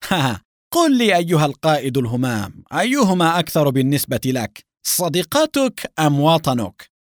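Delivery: high, loud mocking taunt, slowing at the end; sarcastic. A narrator's reading. Agent holding a hostage taunts the captain, sarcastic. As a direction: taunt him with relish, drawing out the final choice. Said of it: "وقال العميل بسخرية".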